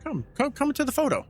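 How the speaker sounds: high-pitched waving-everyone-over voice; insistent, high retold coaxing; imitated eager summons. Actor calls everyone over for photo.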